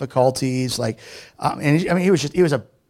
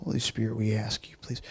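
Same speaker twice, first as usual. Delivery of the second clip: prayer; 4 semitones lower than usual. Acted. Leads prayer.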